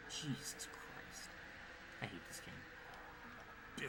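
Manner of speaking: slow, breathless whisper of defeat; weary whisper. Real, not acted. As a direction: whisper it slowly, completely worn out. Worn out by the race.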